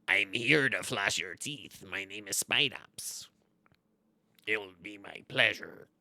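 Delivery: slow, low, whispery villain; menacing creature voice, drawn out; sinister spider character. Acted. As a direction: creep through it slowly, half-whispered. Voices his spider card.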